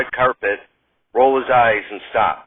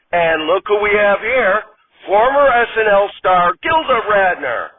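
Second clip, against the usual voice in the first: bright, higher TV host voice, big opening hello; red carpet host; 5 semitones higher than usual. Acted. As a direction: greet the audience like a cheesy awards show host. Host reads the intro again.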